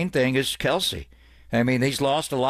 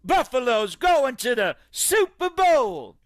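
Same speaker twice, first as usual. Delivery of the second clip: high, slow, shouted fan cheer; fan shouting; 14 semitones higher, slower than usual. Acted. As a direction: yell it like a rabid fan.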